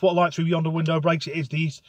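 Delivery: ordinary talk, nothing marked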